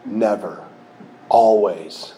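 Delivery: low, ominous single words; slow, portentous low voice of the ego; ego's favorite words. Acted. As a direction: drop deep and pronounce it like doom. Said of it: "one of the ego's favorite words".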